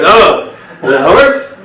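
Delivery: high, loud homeowner's 'oh'; sudden realization. Acted. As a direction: pipe up as the penny drops.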